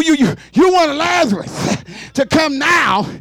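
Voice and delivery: ordinary talk, nothing marked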